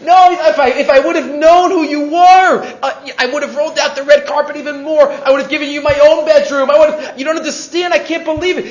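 high, loud, hammed-up regret; distraught apology. Innkeeper to the rabbi he failed to recognize, distraught. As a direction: protest over and over in imitated dismay. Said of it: "He was so sorry".

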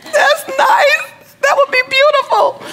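very high, thin comic squeal; hammed-up, tiny high-pitched reply; squeaky overwhelmed voice. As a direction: squeak it out as if choked up. Mock-tearful reaction to friend.

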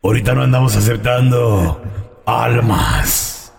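very low, slow rasping demon; raspy devil. Acted. Devil appears to whoever summoned him, deep raspy voice. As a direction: growl it slowly from the depths. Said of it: "Qué voz tan rasposa".

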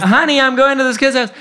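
high, loud, hurried call. Retold or imitated. Puppeteer calls to his partner on leaving, high and hurried. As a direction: excitedly holler the news into the house. Calling to partner inside the house.

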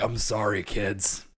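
low, soft, defeated father; apologetic Waddle Dad. Acted. Poor dad apologizing to his children, dejected. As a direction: drop down into a sorrowful apology. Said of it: "is just like".

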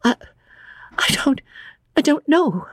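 high, faltering gasp; gasping young woman, lost for words. A narrator's reading. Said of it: "gasped Lucy".

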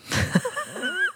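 a squeaky giggle that bursts out mid-line; cracking up in a high squeak; high breaking giggle. Real, not acted. Laughing at her own deadpan line to podcast listeners, high squeaky giggle. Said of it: "I can't even do it on a podcast".

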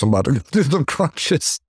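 laughing about going to do crunches. Real, not acted. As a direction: chuckle through the punchline. Joking resolve after body shot.

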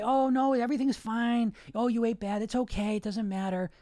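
mock reassurance. Mimicking what the coach voice doesn't say, mock soothing. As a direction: coddle in a falsely soothing voice. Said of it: "It doesn't say".

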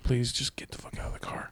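breathless whisper of a fed-up driver; whispered thought. Retold or imitated. Driver silently begging the rider to leave, whispered. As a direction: hiss it under your breath, unvoiced.

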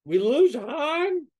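high, loud, slow gasp of disbelief; shocked disbelief. Retold or imitated. Kid in the audience reacting to Han's fate, shocked high cry. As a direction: stretch it out like the floor just dropped. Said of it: "you're like".

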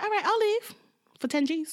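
high, sly ransom demand; name your price in a high voice; high greedy voice. Retold or imitated. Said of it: "she's like".

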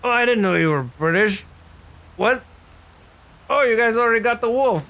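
lightly voiced dim-witted Tuck; Tuck's goofy surprise. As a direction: play a clueless bruiser catching up late. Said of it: "and he's like".

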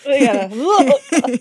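laughing. Retold or imitated. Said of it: "He was like".

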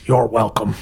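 voiceless, breath-only whisper; whispered reply. Acted. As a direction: whisper back in character. In-character reply to whispered thanks.

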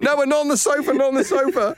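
high, hammed-up insistence on the sofa; cartoonish dad fussing over the sofa; hammed-up frantic dad.

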